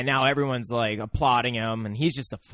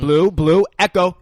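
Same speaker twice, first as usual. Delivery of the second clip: louder, higher trainer's call; 8 semitones higher, 12 dB louder than usual. Retold or imitated. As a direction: summon the raptors by name. Calling raptors by name.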